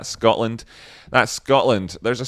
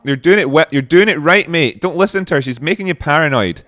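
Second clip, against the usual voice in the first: louder, higher pep talk; insistent, raised-voice support; urgent reassurance; 4 semitones higher, 6 dB louder, faster than usual. Pretend. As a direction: plead with a mate to ignore her. Urging the man in the video.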